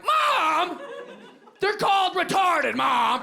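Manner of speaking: high, loud daughter scolding her mother; exasperated yelling. Retold or imitated. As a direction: mimic a mom yelling at her own mom. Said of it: "my mom would yell at her mom".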